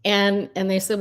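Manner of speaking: ordinary talk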